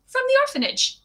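put-on high, bright girlish voice. A narrator's reading. Orphan girl telling Matthew where she's from, high and happy. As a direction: chirp it out happily. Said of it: "she said happily".

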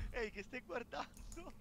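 high, cheeky challenge; playfully voiced face. Viewer voicing the little face to tease Ale, playful high challenge.

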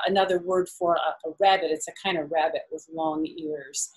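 usual speaking voice

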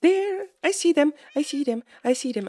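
high, rapid-fire excited spotting. Real, not acted. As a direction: burst out high, then settle with each repeat. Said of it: "I'm very excited right now".

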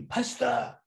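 a high call from outside. Retold or imitated. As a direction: call his name from across the yard. Man calling at the gate.

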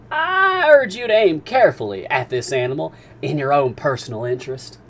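a captain teasing the harpooner's itch; Captain Nemo. Acted. Captain warning Ned.

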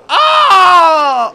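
sudden piercing scream, very high and loud; startled scream. Real, not acted. Streamer spotting a bear, screams in fright. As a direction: jolt out a real shriek of fright.

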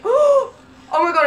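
high, loud gasp of shock; drawn-out startled cry. Real, not acted. Startled by car appearing beside speaker.

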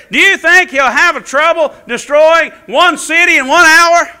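loud, high, driving sermon voice; preaching at full force. Acted. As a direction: preach it hard to the congregation.

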